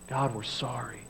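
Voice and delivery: soft, near-whispered prayer for help; hushed plea to God. Retold or imitated. Israelites quietly begging God for help, hushed. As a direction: barely voice the cry to God. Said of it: "they cry out and they say".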